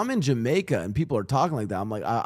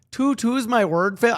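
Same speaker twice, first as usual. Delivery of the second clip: lightly mimicked Toronto slang accent, pitched higher; 9 semitones higher, 5 dB louder than usual. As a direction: parrot the line in a mock Toronto accent. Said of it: "Toronto accent core".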